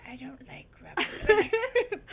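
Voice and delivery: put-on loud, high, drawn-out girlish complaint; hammed-up high protest; protesting little girl. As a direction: play a girl objecting, slowly and loudly. Girl character objects to Robert Land.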